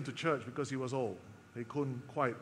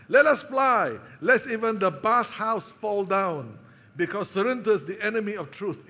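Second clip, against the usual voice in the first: loud, higher alarmed cry to flee; alarmed outcry; 5 semitones higher, 8 dB louder than usual. Acted. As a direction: raise the alarm, get everyone out. John fleeing heretic in bathhouse.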